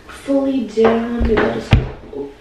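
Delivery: ordinary delivery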